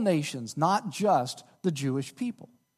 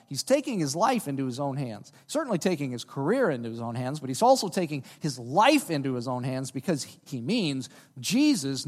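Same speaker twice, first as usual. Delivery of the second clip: usual speaking voice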